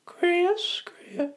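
put-on very high, creepy old man voice; thin, high creepy old-timer calling out; Herbert. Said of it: "creepy old man".

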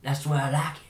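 hammed-up lovey reassurance; doting husband. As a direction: gush it playfully to a spouse. Acted husband answering his wife.

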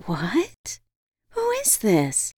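high, slow, wary question; nervous. A narrator's reading. Woman asks the voice in her head, nervous. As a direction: ask the unknown voice nervously. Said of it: "I asked nervously".

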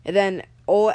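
ordinary delivery

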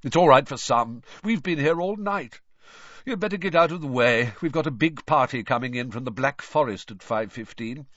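tired, grumbling wizard voice; lightly voiced weary grumble; weary official. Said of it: "said Basil wearily".

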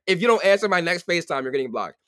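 loud, high-pitched complaint; loud protest. Real, not acted. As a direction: argue your case at full volume. Repeats her rule in protest.

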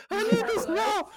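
high, strained mock yell; hammed-up waiter desperation; urgent demand. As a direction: insist furiously at the cook. Acted waiter demanding forgotten order.

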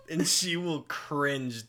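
normal talking voice

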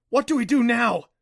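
loud, high desperate question; hopeless. A narrator's reading. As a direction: cry it out in despair. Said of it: "he asked hopelessly".

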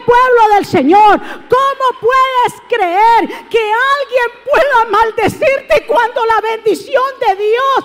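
predicando a plena voz, más alto y fuerte; exhortación encendida a la congregación; predicación. Acted. Preaching at full force. (predicador gritando con fervor)

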